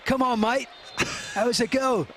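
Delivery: put-on Scouse accent protesting a disallowed goal. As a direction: do a cheeky Scouse footballer complaining. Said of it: "That's my best Scouse accent".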